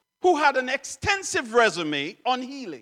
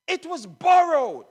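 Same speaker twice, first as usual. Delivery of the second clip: high, louder, fretful cry; anxious cry; 5 semitones higher, 5 dB louder than usual. Acted. Young man crying to the prophet, high anxious cry. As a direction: cry out in alarm to your master. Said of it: "he cried".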